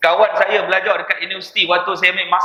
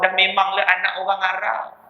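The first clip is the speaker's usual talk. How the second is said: usual speaking voice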